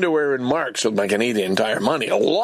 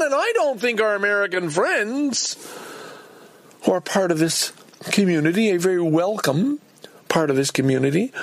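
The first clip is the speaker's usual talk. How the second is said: ordinary delivery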